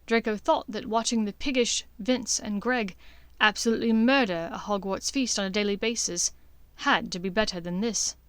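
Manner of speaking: ordinary delivery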